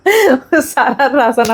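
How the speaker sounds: slow words broken by real laughter; laughing at herself. Real, not acted. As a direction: talk through a giggle. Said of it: "बहुत हंसी आ गई मेरे को".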